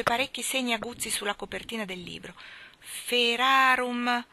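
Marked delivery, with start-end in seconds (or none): 2.8-4.3 drawn-out, stalled repetition; stuck on the same Latin word; very slow. A narrator's reading.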